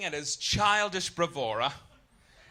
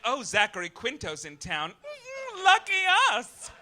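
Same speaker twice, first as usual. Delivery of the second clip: mock swooning fan; 7 semitones higher, 6 dB louder than usual. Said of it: "out there being like".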